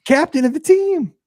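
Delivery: high, loud, drawn-out disbelief; incredulous outrage. Real, not acted. Fan ranting over jailed team captain, incredulous outrage. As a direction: squawk it in comic indignation.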